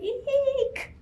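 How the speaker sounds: high squeaky eek; frightened eek. Acted. Owl squeaking in fear for Rabbit, high squeak.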